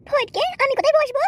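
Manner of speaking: put-on coy young bride. Bride asking the matchmaker where to sit, high coy voice. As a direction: play the shy village girl.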